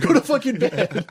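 high, loud mock yell through laughter; imitated coach yelling. Coach sending player to bed, loud high laughing imitation. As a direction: shout the order as the coach, cracking up.

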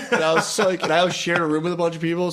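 ordinary talk

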